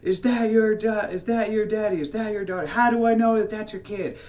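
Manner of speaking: high, loud imitation of a shrieking woman; screamed accusation. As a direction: yell it across a crowd as an accusing lady. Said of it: "she screams across".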